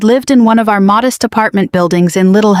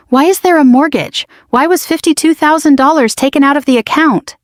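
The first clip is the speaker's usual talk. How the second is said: retold screaming; 6 semitones higher than usual. Patricia retells the widow's angry mortgage call, mildly mimicked screaming. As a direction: imitate an upset caller in a raised pitch, without really screaming. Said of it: "She just called me screaming".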